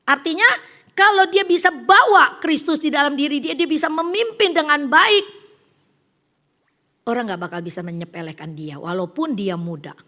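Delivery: usual speaking voice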